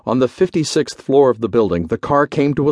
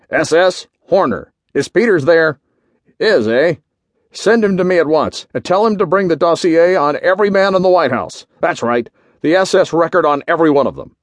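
higher, forceful intercom orders; commanding general; 6 semitones higher, 4 dB louder than usual. A narrator's reading. General demands dossiers over the call set, commanding. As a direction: demand it with urgency and authority.

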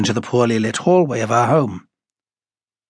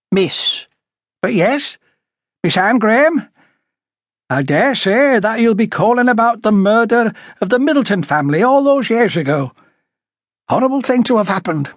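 high, animated character voice; high-voiced talkative householder; 11 semitones higher, 3 dB louder than usual. A narrator's reading. Woman telling police she guessed their case, high and talkative. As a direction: chatter on in a much higher voice. Story character, Miss Graham.

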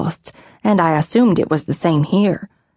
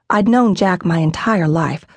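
ordinary delivery

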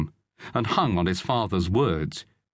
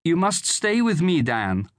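high-pitched woman's appeal; pleading mother; 8 semitones higher than usual. A narrator's reading. Mother begging son to stay with her, pleading. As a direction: implore him as an anxious mother.

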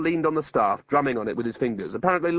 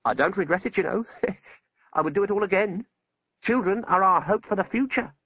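calmer wizard, still pitched up; nearly normal; 7 semitones higher than usual. A narrator's reading. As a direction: settle into an almost conversational voice. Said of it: "In a voice that was nearly normal".

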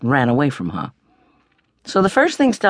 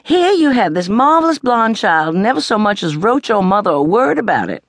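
Mama's scolding voice, pitched up and louder; 8 semitones higher, 4 dB louder than usual. A narrator's reading. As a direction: voice a shocked Southern mother scolding her daughter. Narrator voicing scolding Mama.

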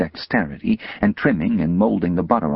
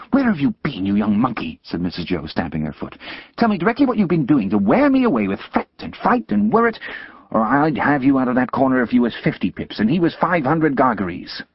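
scolding sister; 6 semitones higher than usual. Acted. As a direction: berate the boy like a furious older sister.

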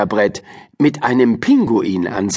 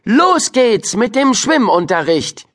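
high, bright call to start; rally the class to begin; brisk teacher; 11 semitones higher than usual. A narrator's reading. Teacher clapping to start swim lesson, high and brisk.

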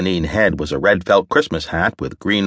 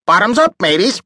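high squawking parrot call, much higher; loud bossy parrot; 3 dB louder than usual. A narrator's reading. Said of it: "added a loud and bossy".

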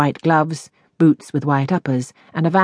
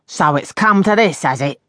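raised voice falling back; indignant landlady. A narrator's reading. Landlady backs out with the letter, indignant challenge. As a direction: confront her as the affronted landlady.

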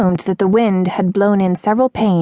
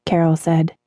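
ordinary delivery

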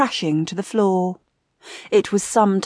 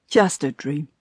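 low, lightly amused aside; mild relieved amusement; chuckled; 6 semitones lower, 3 dB quieter than usual. A narrator's reading. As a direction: murmur it to yourself with a slight chuckle. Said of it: "he chuckled".

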